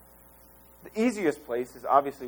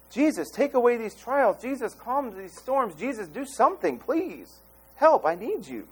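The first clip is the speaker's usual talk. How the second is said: rising, urgent cries to Jesus; a touch of a panicked prayer; anxious pleading prayer; 6 semitones higher than usual. Retold or imitated. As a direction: plead for help in the storm. Believers pray in storms.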